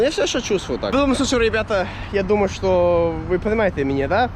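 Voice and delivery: usual speaking voice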